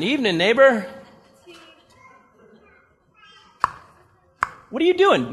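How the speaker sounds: a nosy neighbor peering over; suspicious neighbor. Acted. Neighbor questions Gideon at night, high curious voice.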